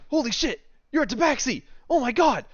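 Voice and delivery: high, loud, thrilled squeal of delight; overjoyed squeal. Acted. Shopkeeper Swain meeting the adventurers, squealing with delight. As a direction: squeal like he just met his idol. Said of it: "he's just like".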